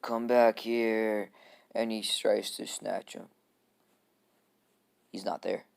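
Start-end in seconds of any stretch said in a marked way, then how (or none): 0.0-1.3 slow, louder drawn-out command; Gaara. Acted. Kid calls after departing Naruto, slow and menacing. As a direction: drag it out as a menacing order. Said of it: "Gaara's like".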